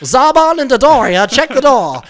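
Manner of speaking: loud, very high villain impression, drawn out; Frieza. Playing the cartoon villain commanding henchmen, high loud impression. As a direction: order the henchmen in a hammed-up high screech. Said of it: "talks like Frieza from Dragon Ball Z".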